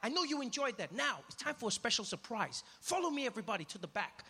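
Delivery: high, upbeat tour host addressing the group. Retold or imitated. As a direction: call the group along like a showman. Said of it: "he walks out and he's like".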